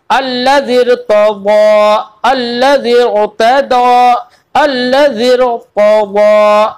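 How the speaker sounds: slow chanted recitation with a heavy, full रा in अल्लज़िर; Quranic recitation, full ra. Shown as a demonstration. Teacher to student, demonstrating the full ra, slowly recited. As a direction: draw out अल्लज़िर with a thick, rounded रा, slowly. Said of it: "बल्कि पुर पढ़ा जाएगा".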